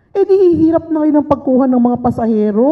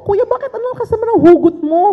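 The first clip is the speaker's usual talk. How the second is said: 1 bright teasing question; 10 semitones higher, 4 dB louder than usual. Retold or imitated. Teasing the taxi driver.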